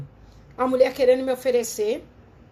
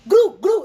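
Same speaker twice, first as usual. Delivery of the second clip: high, loud mock turkey gobble; loud pitched-up turkey noise; turkey gobble imitation; 7 semitones higher, 9 dB louder than usual.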